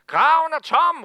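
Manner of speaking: loud, high excited cry; shouting. Retold or imitated. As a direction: call it out breathless with news. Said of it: "råber de". (højt, ophidset råb)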